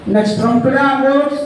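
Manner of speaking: slow, drawn-out chanted pledge; slow group recitation. Real, not acted. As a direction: recite the promise word by word. Class reciting a promise together.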